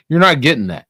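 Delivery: not hit loud and high; loud emphatic. Real, not acted. Ranting lecture punchline.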